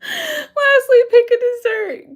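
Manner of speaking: high, laughing read. Real, not acted. Vlogger reading the quiz prompt, still laughing high. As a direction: read it while still giggling.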